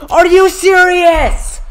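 very high, very loud, drawn-out shriek; furious full-force yell; mad scream. Real, not acted. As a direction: scream it slowly in outraged disbelief. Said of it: "I'm so mad".

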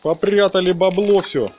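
normal talking voice

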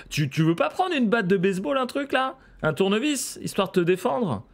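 high, incredulous pleading; exasperated urging. Real, not acted. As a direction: badger the hero in a high, exasperated voice. Urges the game hero to arm himself.

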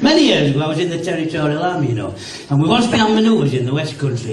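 usual speaking voice

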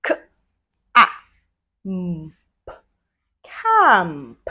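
each sound said apart, then the word; segmented blending. Shown as a demonstration. Teacher showing kids how to blend, sound by sound. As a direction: pause between K, ah, mm, then say can. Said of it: "My turn again".

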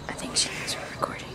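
hushed unvoiced whisper. Real, not acted. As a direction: whisper so she can't hear. Hiding from the neighbor.

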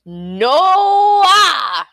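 long, high, swooping shout. A narrator's reading. Little man rejecting the queen's guess, shouting. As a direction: bellow it, stretching the vowel out. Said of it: "shouted he".

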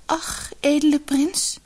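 slow, high, sorrowful appeal; plaintive. A narrator's reading. Outcast girl answering the prince, plaintive. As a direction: sigh it out mournfully in a high voice.

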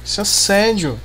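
loud sarcastic complaint; mock the clingy shark loudly; sarcastic yell. Real, not acted. Shark keeps chasing him.

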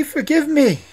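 high, loud plea with a falling end; prince crying out. A narrator's reading. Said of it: "cried the prince".

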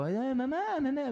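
put-on high whiny kid calling mama; a small son calling for mommy; childish mamá. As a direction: whine it like a little boy. Child calling his mother.

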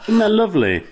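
high, cooing tone; sweetly. Acted. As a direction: coo it sweetly. Mock sweet comment.

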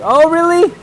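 high, loud sarcastic "really?"; loud squeaky disbelief; mock surprise. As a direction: fake shock to tease the chat.

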